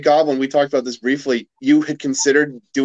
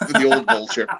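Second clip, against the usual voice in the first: put-on high, laughing old villain voice; a quick cackly old Vulture; 7 semitones higher than usual. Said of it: "That's my Vulture impression".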